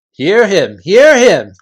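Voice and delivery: loud, high chanting of agreement; eager chorus voices. Acted. Followers backing their chief, loud and eager. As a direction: cheer him on as a crowd of followers.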